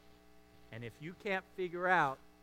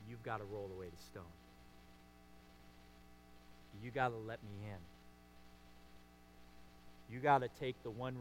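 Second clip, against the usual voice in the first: softer, intimate voicing of Jesus; gentle Jesus voice; 4 semitones lower, 6 dB quieter than usual. Preacher voicing Jesus pleading with Martha, soft and intimate. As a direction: speak as Jesus coaxing a grieving friend.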